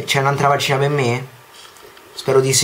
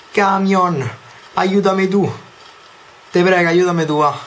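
pleading; 6 semitones higher than usual. Pretend. Begging a truck for pages.

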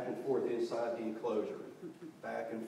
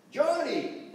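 high, loud reprimanding call; acted scolding mom; scolding mother; 13 semitones higher, 7 dB louder than usual. A narrator's reading. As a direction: call the boy out sternly. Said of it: "his mother scolded".

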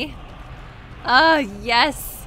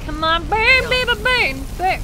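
gleeful urging; 8 semitones higher, 5 dB louder than usual. Real, not acted. Urging enemy to burn.

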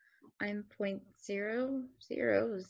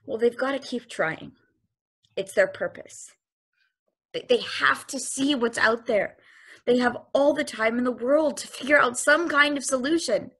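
rising insistence; 2 semitones higher, 10 dB louder than usual. Acted. Machine pleading for humanity's purpose, building to a shout.

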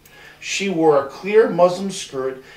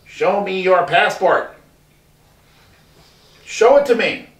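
demanding; 3 semitones higher, 4 dB louder than usual. A narrator's reading. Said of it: "the rat demanded".

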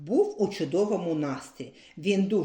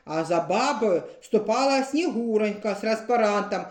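ordinary delivery

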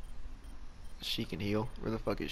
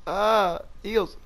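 panicked call; 11 semitones higher, 6 dB louder than usual. Real, not acted. Begging for healing.

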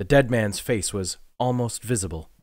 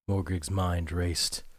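normal talking voice

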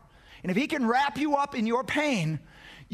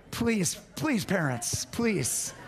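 ordinary talk, nothing marked

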